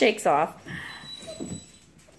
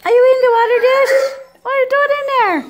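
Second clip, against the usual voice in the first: excited; 6 semitones higher, 8 dB louder, much faster than usual. Real, not acted. Puppy in water dish.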